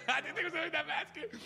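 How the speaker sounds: host repeats the line to listeners, now in loud amazed disbelief; excited disbelief. Real, not acted. Belt it out again, floored that he scored.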